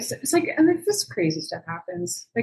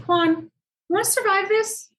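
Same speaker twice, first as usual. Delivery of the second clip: exasperated scolding; 6 semitones higher than usual. Retold or imitated. Scolding Juan over his swagger.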